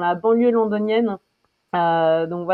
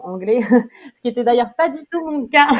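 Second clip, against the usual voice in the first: amused, words through a laugh; light self-mocking laugh; laughing while talking; 5 semitones higher, 7 dB louder, faster than usual. Real, not acted. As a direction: laugh at your own slip. Laughs at own English.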